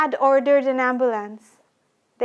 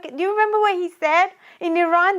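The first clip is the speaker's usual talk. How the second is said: ordinary talk, nothing marked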